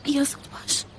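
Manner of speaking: urgent hushed whisper. Acted. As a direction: whisper so the mother-in-law won't hear. Secretly letting sister in. (نجوای فوری و آهسته)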